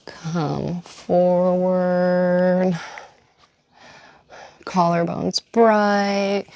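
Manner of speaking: slow, stretched cues with long pauses; guided yoga flow. Acted. Yoga teacher cueing several rounds with the breath, slow and drawn out. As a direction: let each word last as long as a breath.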